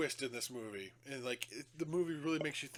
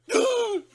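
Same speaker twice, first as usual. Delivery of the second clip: sharp high gasp; 14 dB louder than usual. Retold or imitated. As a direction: gasp in fright. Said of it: "He's like".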